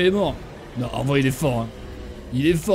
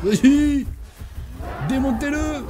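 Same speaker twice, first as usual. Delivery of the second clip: high-pitched call to attack; battle cry; 8 semitones higher, slower than usual. Real, not acted. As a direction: cry out high to send the team in. Urging team to destroy enemy.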